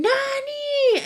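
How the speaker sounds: high desperate call of the name; screamed name. Retold or imitated. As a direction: call out across the street in panic. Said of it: "I just screamed".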